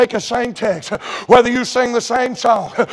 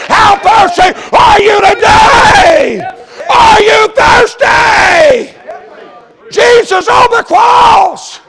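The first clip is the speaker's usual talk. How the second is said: high, slow, stretched-out preaching shout; shouted appeal; 11 semitones higher, 8 dB louder, much slower than usual. Real, not acted. Preacher at climax challenging the congregation, shouted appeal. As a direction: cry it out at full force, holding the words.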